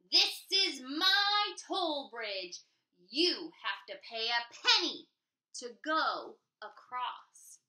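loud, slow troll demand. Acted. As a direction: demand the toll like a gruff troll. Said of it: "said the troll".

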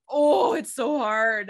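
loud, exasperated cry. Real, not acted. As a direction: throw it out loudly in frustration. Exasperated sigh over acting.

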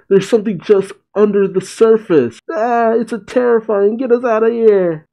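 high, hammed-up panicked yell; exaggerated high-pitched cry for help; mock screaming. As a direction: squeal it like a crew in terror. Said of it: "Straw Hats scream and yell".

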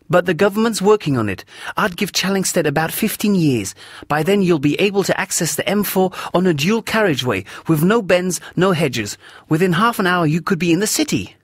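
high, pressing woman's voice building in heat; a woman who feels strongly, getting worked up; indignant Elvira. Acted. Elvira rants about urban sprawl.

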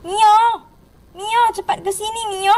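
play-acted urgent calling. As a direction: call a lost little one over. Calling the hiding child.

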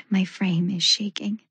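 usual speaking voice

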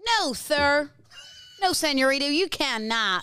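hammed-up American accent; put-on American accent. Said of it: "That's not a bad accent".

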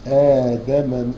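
آدم with the opening long vowel drawn out two beats; tajweed recitation of آدم; stretched madd. Shown as a demonstration. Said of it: "حركتان جائز يعني".

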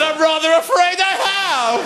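high, prim understatement; prim housewife. Retold or imitated. Pupil Karen politely admitting she was locked in, high and prim. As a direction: say it up high like a proper little lady. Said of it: "spoke like a repressed nineteen forties housewife".

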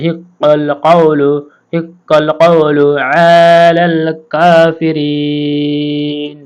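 slow, drawn-out chanted recitation; Quran recitation. Acted. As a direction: stretch each vowel like tilawat. Recites the verse melodically.

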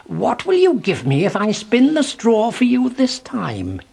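strange little man voice. A narrator's reading. Mannikin voiced in story.

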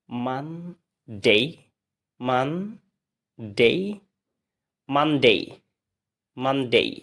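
"মানডে" as man-dei, split and rising, then whole; slow rising "মানডে" twice, then joined and falling; practice drill. Shown as a demonstration. Teacher leads repeat practice, "মানডে" stretched then joined. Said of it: "এবার আবার practice করি".

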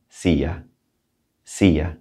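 "Silla" said SEE-yah, the LL as Y; "Silla" with double L like an English Y; Spanish silla. Shown as a demonstration. Teacher models "Silla" for students, LL as Y. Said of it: "See the double L's? Has a Y sound?".

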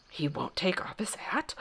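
hushed gossiping whisper. Acted. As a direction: whisper it like courtiers behind doors. Muttering voices behind doors.

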